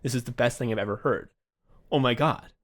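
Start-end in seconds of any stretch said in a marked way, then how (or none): none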